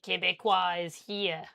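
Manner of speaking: hammed-up nasal Quebecois French accent. Shown as a demonstration. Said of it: "it's a bit more, like, like, nasally".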